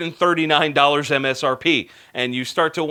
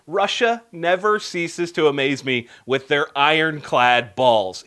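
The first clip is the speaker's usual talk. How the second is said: ordinary talk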